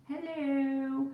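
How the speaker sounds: high, drawn-out playful hello; playful greeting. Pretend. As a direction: sing out a teasing compliment.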